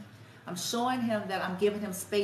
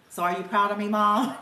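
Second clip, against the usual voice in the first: lightly voiced eager son, louder; a hint of a kid seeking mom's praise, pushed louder; imitating her son; 7 dB louder than usual. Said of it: "'cause that's how he talks".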